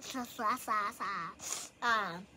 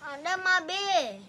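mocking whine; 9 dB louder, slower than usual. Mocking friend's complaint.

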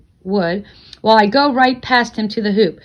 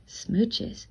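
breathy whispered smooches to himself; soft whisper into the empty room; 13 dB quieter than usual. Acted. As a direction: whisper it alone in the dark. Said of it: "Tonight I whisper".

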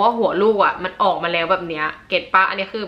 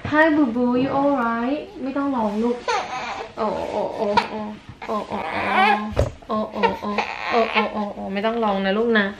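gentle mother's cooing, much higher and slow; 3 semitones higher, slower than usual. Real, not acted. Mother soothing crying baby.

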